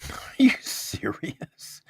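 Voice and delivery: hushed, breathless disbelief; whispered. Real, not acted. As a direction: whisper it in shock. Shocked reaction, holding laughter.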